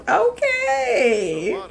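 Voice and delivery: high squealing okay; shocked squeal. Real, not acted. Gamer shrieks at her Sim's surprising move. Sim does something shocking.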